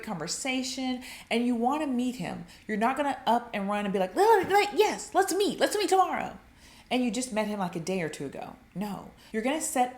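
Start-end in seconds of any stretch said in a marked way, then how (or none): 4.1-6.4 high, loud gushing mock excitement; hammed-up eager yes; over-eager. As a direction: gush like you can't wait. Eager woman acted out mockingly.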